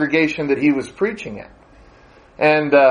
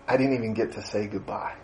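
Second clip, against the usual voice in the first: quiet, choked-up words of loss; tearful; 6 semitones lower, 13 dB quieter, faster than usual. Retold or imitated. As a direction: speak it softly, near tears. Barry had tears in his eyes.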